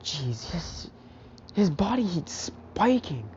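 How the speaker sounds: normal talking voice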